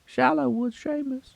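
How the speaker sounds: high-pitched rural Southern twang; High Woods Seamus. Retold or imitated. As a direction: squeak out a backwoods twang. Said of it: "I'm doing a High Woods Seamus".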